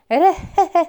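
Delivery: high, loud croaking laugh; frog laugh. Acted. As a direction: cackle like a frog. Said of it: "zaśmiała się znowu żabka".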